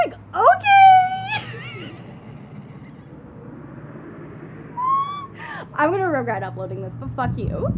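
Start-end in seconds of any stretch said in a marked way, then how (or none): 0.5-2.0 very high, loud squeaky okay; mock-cheerful, piercingly high reply; smiling okay. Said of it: "I just smiled at him". 2.0-5.7 long high squeal of laughter; helpless high laugh trailing off. Real, not acted. Laughs after own punchline.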